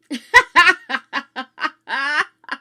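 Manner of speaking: loud high burst of laughter; big laugh. Real, not acted. Laughing loudly to chat after red rag joke. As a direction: laugh out hard at your own line.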